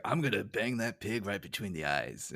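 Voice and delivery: lightly voiced Snake, English-accent-free; Snake impression. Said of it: "my Snake impression".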